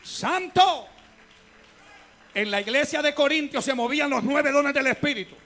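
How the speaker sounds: fast, driving repeated refrain; rhythmic preaching. Acted. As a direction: preach the list with growing drive. Preaching build-up, repeated phrase.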